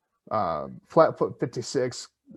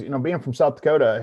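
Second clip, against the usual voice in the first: ordinary talk, nothing marked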